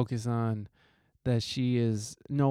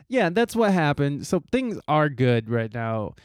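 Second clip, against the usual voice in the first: ordinary talk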